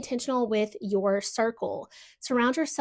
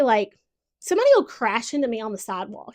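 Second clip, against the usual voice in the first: usual speaking voice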